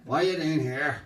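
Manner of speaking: loud, high-pitched Northern Irish challenge; thick Northern Irish accent. Retold or imitated. Policeman questioning men in a car, thick Northern Irish accent. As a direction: belt it out in broad Northern Irish. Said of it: "he says in his thick Northern Ireland accent".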